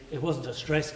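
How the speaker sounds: usual speaking voice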